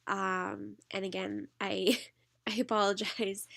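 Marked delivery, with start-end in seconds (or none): none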